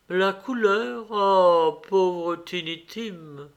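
a touch of sorrow, drawn out on the name; mild lament. A narrator's reading. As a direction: sigh it out as a grieving mother, slowing at the end. Mother grieves dead Tiny Tim.

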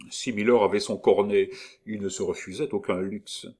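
usual speaking voice

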